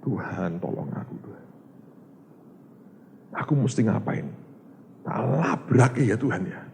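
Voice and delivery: soft, near-whispered plea to God; hushed prayer. Retold or imitated. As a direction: pray it under your breath. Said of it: "Untungnya saya bilang". (doa lirih setengah berbisik)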